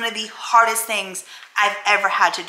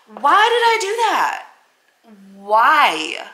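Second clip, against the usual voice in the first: anguished; 3 semitones higher, 3 dB louder, slower than usual. Real, not acted. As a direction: plead with yourself in disbelief. Anguished self-questioning.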